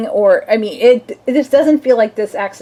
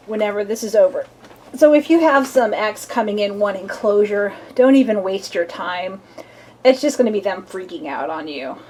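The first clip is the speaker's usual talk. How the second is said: usual speaking voice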